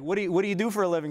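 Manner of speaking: put-on high, fast mom question without the accent. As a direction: rattle it off like mom, dropping the accent. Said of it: "It didn't work well".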